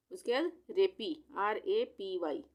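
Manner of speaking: ordinary talk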